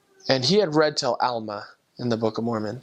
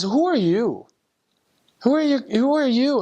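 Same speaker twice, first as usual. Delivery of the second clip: rising, louder, higher repeated question; overwhelmed pleading; 11 semitones higher, 4 dB louder than usual. Retold or imitated. Tearful convert demands who the missionaries are: higher, louder plea. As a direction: ask again and again, each one more urgent. Investigator pleads, crying, in story.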